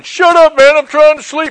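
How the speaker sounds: high-pitched mock holler from a cell; imitated annoyed yell. Inmates in the brig to noisy drunk, mock yelling.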